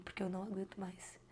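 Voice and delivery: breathy whisper of exhaustion; whispered plea. Retold or imitated. Begging God to lift her sadness, whispered despair. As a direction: let it fade into a defeated whisper.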